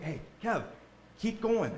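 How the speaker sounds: lightly voiced relatives urging him onward; relatives cheering on. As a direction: cheer someone on near the finish. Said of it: "Think of our family members in heaven".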